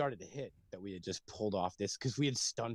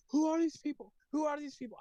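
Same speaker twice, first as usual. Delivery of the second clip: mimicking a panicked mom, much higher; shrill retold panic, pitched way up; freaked-out mom; 3 dB louder than usual. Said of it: "she freaked out".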